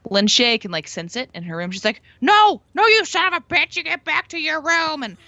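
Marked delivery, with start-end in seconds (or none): 2.2-5.0 high, loud furious scolding; angry old woman. Retold or imitated. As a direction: scold the ghost fiercely. Quoting Lin Shaye scolding ghost.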